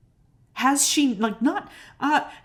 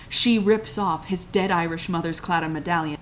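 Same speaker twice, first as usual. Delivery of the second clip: ordinary talk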